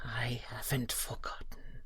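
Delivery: soft whisper, nearly no voice; whispered reply. Acted. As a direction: answer in a hushed whisper. Quiet answer under pressure.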